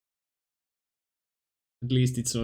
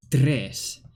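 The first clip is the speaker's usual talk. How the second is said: Spanish pronunciation; 3 dB louder than usual. Pretend. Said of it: "that was good Spanish".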